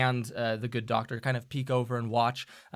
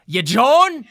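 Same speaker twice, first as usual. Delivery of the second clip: gruff sailor; 11 semitones higher, 13 dB louder than usual. Acted. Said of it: "and goes".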